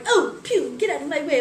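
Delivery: high, loud put-on rudeness; mock disgust. Imagined fisher girl snubbing old lady, mock disgust. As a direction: dismiss them with exaggerated disgust.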